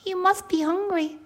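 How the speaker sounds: high-pitched friendly bear cub; little bear. Acted. Baby bear offers breakfast to his guest, high little voice. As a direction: pipe up like a small bear. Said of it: "said the little bear".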